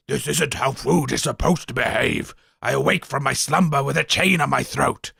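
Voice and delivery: mock death throes, dropping low; dying guzzard. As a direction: fade away like a dying beast. Guzzard wakes and dies.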